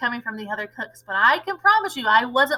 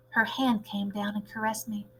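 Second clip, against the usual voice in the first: plain reading voice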